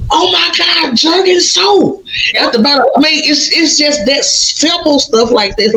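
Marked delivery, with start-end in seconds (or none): 0.1-2.0 high, slow amazed cry of discovery. Retold or imitated. Telling how she found grandma's soap, high amazed cry. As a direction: gasp it out, stunned to find it.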